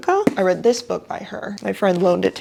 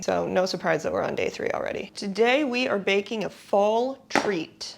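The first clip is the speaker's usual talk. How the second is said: usual speaking voice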